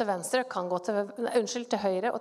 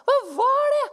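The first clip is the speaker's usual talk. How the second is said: loud, high-pitched astonishment on waking; startled amazement; 6 semitones higher, 7 dB louder than usual. Retold or imitated. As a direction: burst out, stunned and loud. (høylytt, forbløffet utbrudd)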